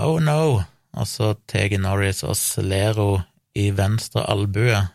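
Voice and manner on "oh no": higher-pitched, mild "oh no!"; retold cry out. Retelling the victim seeing the sledgehammer, mock cry out. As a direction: quote a cry of dread without real volume. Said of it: "og roper".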